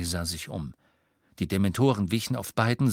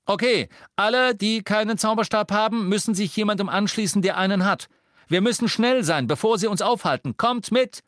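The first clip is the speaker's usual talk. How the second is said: loud, high rallying call; rally a crowd to hurry; urgent commands; 12 semitones higher, 6 dB louder than usual. Acted.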